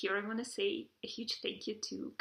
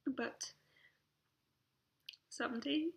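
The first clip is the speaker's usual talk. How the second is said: tearful, voice breaking; choked up and halting; crying; 3 semitones higher than usual. Real, not acted. Crying while addressing niece.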